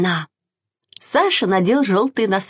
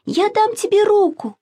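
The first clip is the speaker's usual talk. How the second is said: put-on high, urgent little-girl shout; urgent call; 13 semitones higher than usual. A narrator's reading. Masha shouts to Sasha stuck in the stream. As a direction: call out to hurry him. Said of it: "закричала Маша".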